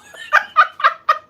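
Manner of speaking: loud, high cackling laugh; loud high cackle. Real, not acted. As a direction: cackle loudly at the troll. Laughing at own roast.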